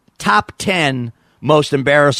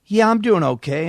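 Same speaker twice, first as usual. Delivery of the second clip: woman co-host; 8 semitones higher than usual. Acted. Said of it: "And she said".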